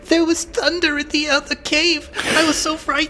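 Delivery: high-pitched, cowering lackey voice; frightened toady. Acted. Toady explaining himself to the guard, in a high frightened voice. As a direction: whimper excuses as the high-voiced sidekick. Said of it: "like, his toady".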